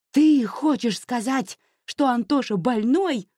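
high-pitched outraged yell. A narrator's reading. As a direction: burst out, shouting at mother. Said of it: "заорала она на всю улицу".

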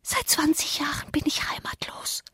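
put-on high, very soft child-ghost whisper; ghostly whisper. A narrator's reading. Child ghost answers from outside the window, whispering. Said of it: "flüsterte die Stimme".

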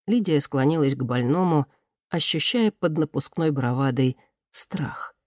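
ordinary delivery

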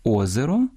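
"Озеро" stressed on the opening О; stress on first syllable. Shown as a demonstration. Host showing singular stress, "Озеро" with first-syllable stress. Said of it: "зберігають наголос однини".

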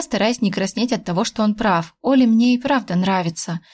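usual speaking voice

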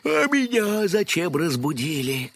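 sleepy yawning question. A narrator's reading. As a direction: yawn through the words. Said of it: "зевнул ёжик".

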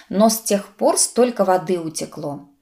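plain reading voice

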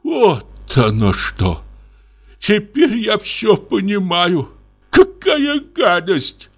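the captain realizes he was hired as a fake general; sudden realization. A narrator's reading. Realization turning to outrage. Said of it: "Сообразив".

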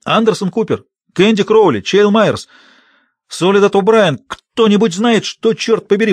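high, urgent, rising panic; real alarm. A narrator's reading. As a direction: call out names in growing alarm. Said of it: "в голосе слышна настоящая тревога".

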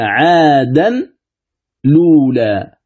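"عادا لولا" in two parts, 'aadan, then luulaa; word built in parts. Shown as a demonstration. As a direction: separate "عادا" with a clear n from a drawn-out "لولا". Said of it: "إذن صارت الكلمة".

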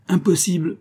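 high, shrill interjection; shrill voice from the back of the room. A narrator's reading. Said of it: "dit une voix criarde au fond de la chambre".

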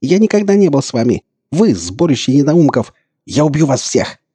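high, loud frenzied outburst; unhinged, squealing threats; hysterical shriek. A narrator's reading. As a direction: rage in a screeching voice. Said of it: "сорвавшись на визг".

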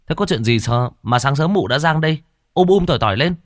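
put-on a young man asking what the fuss is; puzzled young man. Hoán asking Hoa. (thằng Hoán hỏi giọng cao, ngạc nhiên)

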